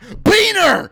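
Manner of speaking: high, very loud yell; mock shout. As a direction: yell it like a sudden alarm. Acts out snap judgement at name.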